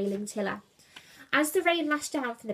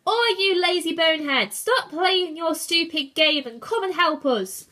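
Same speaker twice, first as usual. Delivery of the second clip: loud, raised scolding yell; angry shout; 7 semitones higher, 9 dB louder than usual. A narrator's reading. Julius calls idle crewmates to help, angry shout.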